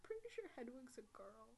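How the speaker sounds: very soft, half-whispered aside; hushed interjection; murmured correction. Real, not acted. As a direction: slip the correction in under your breath. Murmured correction under the host's explanation.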